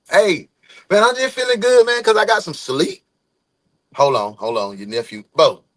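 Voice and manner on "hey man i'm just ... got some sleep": loud, high burst of good cheer; upbeat hype. Real, not acted. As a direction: burst out feeling great. Snaps back into upbeat hype.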